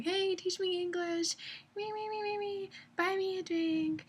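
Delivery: put-on high, soft, slow girly voice; mock coy come-on, very high and breathy-free. Imitating scam women.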